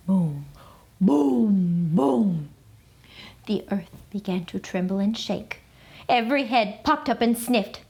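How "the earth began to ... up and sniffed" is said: usual speaking voice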